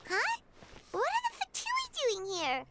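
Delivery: high squeaky fairy companion; bright little fairy, surprised at the Fatui; Paimon voice. Acted. Paimon reacting to the Fatui, high and surprised. Voicing Paimon reading game dialogue.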